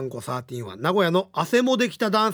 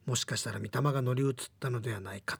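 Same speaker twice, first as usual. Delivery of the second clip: whispered, softer spooky line; hushed creepy ghost story; 4 semitones lower, 7 dB quieter than usual. Acted. Ghost letter's eerie line.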